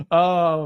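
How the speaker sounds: high, loud real laugh breaking out; burst of laughter. Real, not acted. Streamer laughs at a fan's four-dollar buy, laughing hard. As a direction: crack up at the price.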